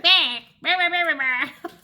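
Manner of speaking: high, loud bratty bleating; Cartman. Acted. Cartman answering his doting mother, mock bratty bleating. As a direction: imitate a whining brat, loud and high. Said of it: "And he's like".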